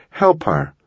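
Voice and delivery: urgent cry, not full volume; contained scream for help; narrated scream. A narrator's reading. Caitlin calling to the fireman for help, mild scream. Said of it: "she screamed at the fireman".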